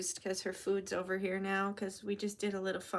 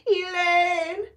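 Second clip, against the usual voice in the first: higher, louder anguished wail; screaming Elaine; 8 semitones higher, 8 dB louder than usual. Retold or imitated. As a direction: cry out the name in despair. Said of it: "He literally screams for her".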